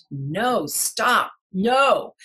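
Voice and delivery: re-enacted loud, high refusal; retold scream. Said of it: "like, really loud".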